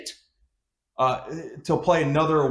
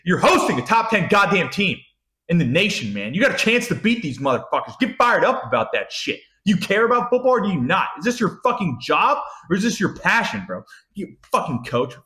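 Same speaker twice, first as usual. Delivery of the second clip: heated, higher-pitched berating; fired-up rant; 4 semitones higher than usual. Real, not acted. Fan berating a coach who won't get fired up, exasperated. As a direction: lay into the coach, hammering each challenge.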